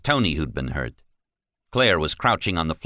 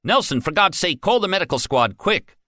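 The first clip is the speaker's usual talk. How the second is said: higher, urgent and rushed; panicked plea; 12 semitones higher, 3 dB louder than usual. Acted. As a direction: beg for help right now. Shaken guard calls for medics.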